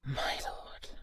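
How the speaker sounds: whispered, barely voiced; hushed whisper. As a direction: breathe it out to your lord in a hush. Hushed reply to the lord.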